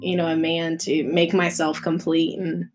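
usual speaking voice

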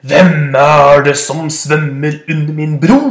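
slow loud roaring challenge; deep booming voice. Acted. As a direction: boom it like a big goat. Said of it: "voldsomme, mute, mørke stemmen". (langsom dyp brølende stemme)

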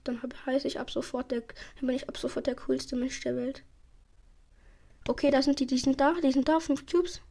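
usual speaking voice

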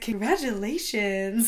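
drawn-out, mock-enthusiastic salesman congratulations; over-the-top salesman. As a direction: imitate a salesman trying really hard. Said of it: "like, over the top".

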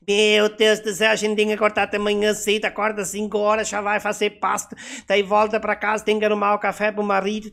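high, loud flustered farm wife; Herta. Acted. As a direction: rattle off chores in a frantic high voice. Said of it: "A Herta sempre brinca".

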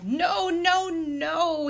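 high, loud, wailing protest; upset cry. A narrator's reading. Master protesting at the axe, upset cry. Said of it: "He sounded upset".

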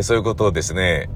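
ordinary talk, nothing marked

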